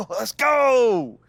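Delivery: excited cheer. Acted. Celebrating news of starting.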